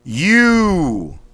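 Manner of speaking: You punched out high and loud in one breath; thrust out sharply. Shown as a demonstration. Coach drilling warm-up, sharp loud thrust-out You. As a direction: dump all the air on You. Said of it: "Thrust out quickly, sharply".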